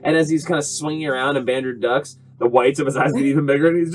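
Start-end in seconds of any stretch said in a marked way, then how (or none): none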